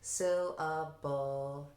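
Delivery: "Syllable" very slow, each part held. Shown as a demonstration. Teacher leading the kids, "Syllable" stretched slowly into three held parts. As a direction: hold each beat of "Syllable" evenly. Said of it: "So I'll say".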